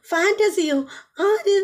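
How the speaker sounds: high, sharp indignant question; a wife hearing she is only a fantasy; outraged wife. Retold or imitated. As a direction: fling it back in disbelief.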